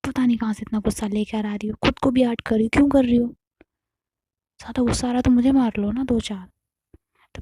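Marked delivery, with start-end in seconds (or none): 1.8-6.5 louder, pressing, fast at the end; exasperated loud pleading with the furious partner; loud exasperated plea. Retold or imitated. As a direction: push the plea out loud and urgent.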